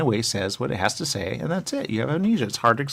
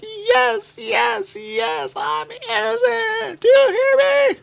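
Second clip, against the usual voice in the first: ecstatic shout; 7 dB louder, much slower than usual. Retold or imitated. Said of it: "I was like".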